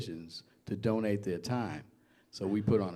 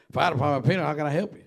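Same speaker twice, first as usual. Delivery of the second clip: quick New England phone greeting, fast and louder; answering the fire department phone in a New England accent; 5 semitones higher, 8 dB louder, faster than usual. Retold or imitated. Young firefighter answers the station phone in New England accent. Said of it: "in my northern New England accent".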